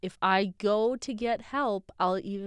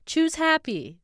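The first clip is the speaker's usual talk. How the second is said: mocking chirpy advice; 10 semitones higher, 6 dB louder than usual.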